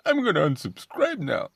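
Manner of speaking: ridiculing put-on high voice; mock petulant. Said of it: "that's just so bitch made".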